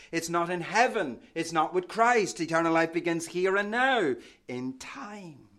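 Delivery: ordinary talk, nothing marked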